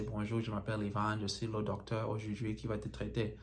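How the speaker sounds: native French greeting, spoken soft; "Bonjour, je m'appelle Ivan" in fluent French; French introduction. Shown as a demonstration. Greeting a French-speaking patient, in French. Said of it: "I have an introduction in French".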